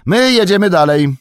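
high, loud announcement; sustained high-pitched holler; calling back. A narrator's reading. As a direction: shout it back while riding off. Merry calls back to escort.

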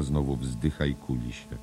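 usual speaking voice

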